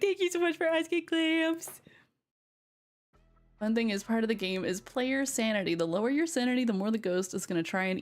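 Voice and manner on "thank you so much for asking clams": high, quick, gushing thanks; bright thanks. Real, not acted. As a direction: sound thrilled they asked. Thanking viewer sweetly.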